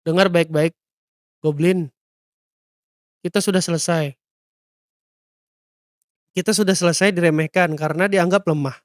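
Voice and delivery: grave, measured declaration; understated solemn resolve; serious. Acted. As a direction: address a loyal partner in earnest. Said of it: "berkata dengan serius".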